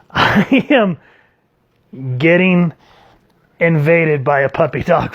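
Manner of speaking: giggling while talking; laughing. Real, not acted. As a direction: laugh through the words. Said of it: "apologize if my, uh, voice breaks".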